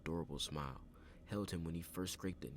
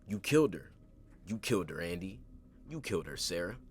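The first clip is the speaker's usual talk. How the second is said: gloating killer; 3 semitones higher, 8 dB louder than usual. A narrator's reading.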